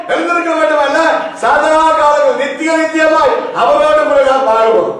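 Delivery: forceful sermon declaration; impassioned preaching. Acted. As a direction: preach it out to the congregation.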